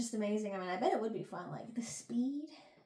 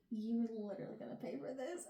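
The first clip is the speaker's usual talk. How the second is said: alpha dominance; 10 dB quieter, faster than usual. Pretend. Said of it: "I would turn on that little alpha thing".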